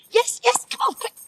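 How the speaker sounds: breathless whispered impatience; urgent craving. Acted. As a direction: hurry them up, desperate for a smoke. Urging for the smoke.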